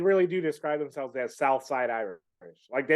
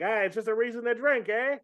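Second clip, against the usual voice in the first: lightly voiced Italian guy, pitched up, shrugging it off; mock north side Italian; 8 semitones higher, 3 dB quieter than usual. Friend mocks north side Italians, a pitched-up light Italian-guy impression. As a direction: toss it off like a carefree Italian guy who just wants a drink. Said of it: "you have Italians that are in there that are like".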